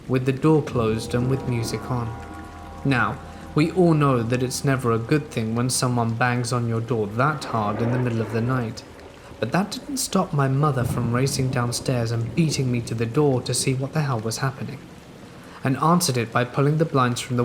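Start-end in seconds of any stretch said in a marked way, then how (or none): none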